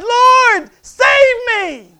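huge high shout for rescue; crying out. Acted. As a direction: yell it like a drowning man. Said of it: "He cried out".

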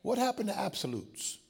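ordinary delivery

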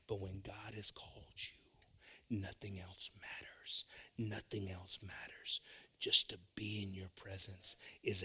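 preacher lowering to a hush for the congregation; hushed preaching. Acted.

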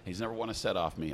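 usual speaking voice

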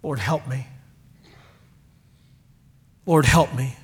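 low, soft pleading; hushed humble cry for help; desperate prayer. Retold or imitated. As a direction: pray it at his feet, barely aloud. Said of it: "need to come at his feet and say".

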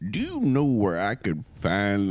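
put-on slow, folksy old man; old man's accent. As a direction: imitate a drawling old man asking directions. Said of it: "what's up with that old man's accent?".

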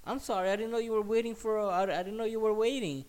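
high, loud mimicked apology; mock-apologetic woman's voice; apologetic lady. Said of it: "and she goes".

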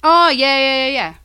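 loud, high burst of real recognition; sudden recognition. Real, not acted. Listener reminded of Roseanne Barr's scandal, loud sudden recognition. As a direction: exclaim as the memory comes back.

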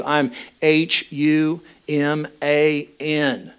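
H U M A N spelled letter by letter, slowly. Shown as a demonstration. Pastor spelling H U M A N to the congregation, slow and deliberate. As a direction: spell H U M A N out slowly to drive it home.

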